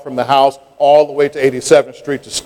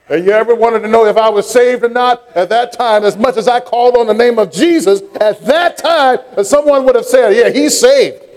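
rising preach; 8 semitones higher than usual. Acted. Preaching builds, higher pitch.

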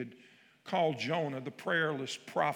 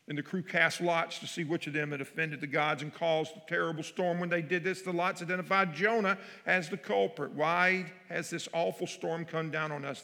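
higher, louder storytelling read; dramatic scripture reading; 3 semitones higher, 6 dB louder than usual. Acted. Pastor reading Jonah to the congregation, dramatic and loud. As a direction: read Jonah like a gripping tale.

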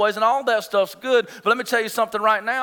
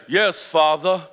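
obedient son; 3 semitones lower, slower than usual. Retold or imitated. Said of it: "he would say".

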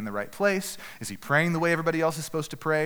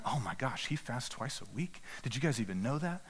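hushed gossip; 9 dB quieter than usual. Acted. Temple crowd murmuring admiringly about the Pharisee's fasting, hushed. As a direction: gush quietly like impressed onlookers.

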